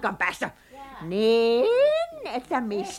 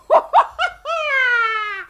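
gleeful witch cackle; 11 semitones higher, 4 dB louder than usual. Acted. Witch celebrates winning the trip.

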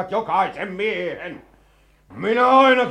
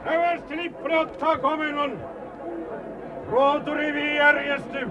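high-pitched parade-ground roar; roaring commands; 9 semitones higher than usual. Acted. Colonel rallying his rebel troops to overthrow the ruler, roaring. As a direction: bellow orders to troops from a distance. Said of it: "Niitä komentaa kauheasti karjuva mies".

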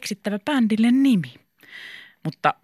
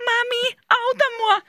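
very high silly squeal; hammed-up cry for mummy; ridiculous voice. A narrator's reading. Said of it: "sanoi Mika naurettavalla äänellä". (naurettava kimeä ääni)

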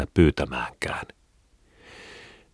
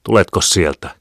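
sharp order, raised and loud; angry roar; 5 semitones higher, 7 dB louder than usual. A narrator's reading. Mother ordering her son out of hiding, barking angrily. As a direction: bark it at a hiding child. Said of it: "hän ärjäisi".

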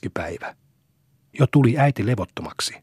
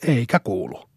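indignant. A narrator's reading. Maid reports the boy cannot be heard, mildly indignant. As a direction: report back with a touch of annoyance. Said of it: "ilmoitti jo närkästyneen äänellä".

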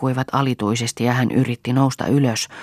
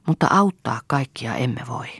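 ordinary delivery

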